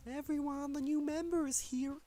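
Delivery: put-on very high, bright girl voice calling out; high chirpy announcement; energetic Sayori. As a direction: announce it like an excited schoolgirl. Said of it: "Sayori, full of energy".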